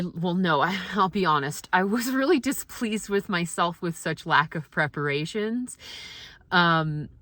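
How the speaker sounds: normal talking voice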